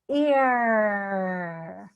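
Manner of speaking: long "ear", gliding from ee into a held r; smile, then pull the tongue back through "ear"; stretched ear sound. Shown as a demonstration. Coach drilling the ear sound, stretched "ear". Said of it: "It goes".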